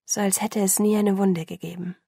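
plain reading voice

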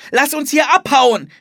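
high, loud shout to get away; urgent call. A narrator's reading. Philipp wants to escape the tornado, shouting urgently. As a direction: call out in alarm, pitched high. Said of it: "rief Philipp".

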